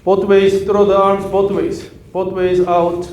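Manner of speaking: loud coach shouting instructions; called-out cues. Real, not acted. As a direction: call the cues out to the student. Coach calling out cues loudly.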